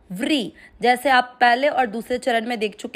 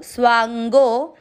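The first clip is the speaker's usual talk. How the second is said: slow demo; 3 dB louder, slower than usual. Shown as a demonstration. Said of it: "और फिर गो".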